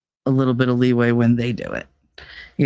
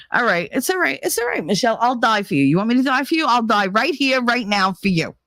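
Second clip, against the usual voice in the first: aggravated grandma; 8 semitones higher than usual. Retold or imitated. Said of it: "and if she was aggravated with me".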